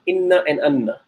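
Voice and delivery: inna with i, anna with a, both with a long nn; contrasting inna and anna. Shown as a demonstration. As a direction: set inna against anna, same doubled n. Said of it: "the difference is very subtle".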